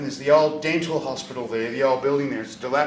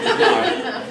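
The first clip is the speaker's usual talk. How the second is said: high mock nurse refusal; 9 semitones higher, 6 dB louder than usual.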